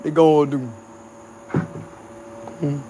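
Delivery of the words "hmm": usual speaking voice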